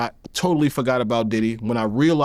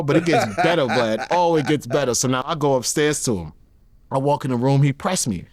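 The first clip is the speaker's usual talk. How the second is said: usual speaking voice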